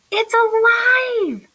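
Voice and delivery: high, slow and thrilled; excited gasp. A narrator's reading. Buddy thrilled at the snuffling thing, high and slow. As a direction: marvel at the living thing.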